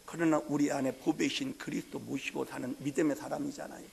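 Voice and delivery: ordinary talk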